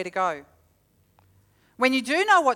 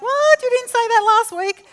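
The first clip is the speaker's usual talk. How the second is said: high, loud shocked cry; shocked outburst; 12 semitones higher, 8 dB louder than usual. Retold or imitated. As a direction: blurt it out in disbelief at the dentist. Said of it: "I'm like".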